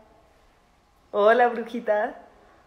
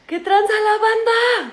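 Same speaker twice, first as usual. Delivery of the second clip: high-pitched greeting; 11 semitones higher than usual. Pretend. High greeting echoed by co-host.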